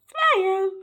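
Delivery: high, loud, sing-song smile; stretched, beaming call to smile; bright cheery call. Real, not acted. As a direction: throw the word out with a big grin. Sign-off urging viewers to smile.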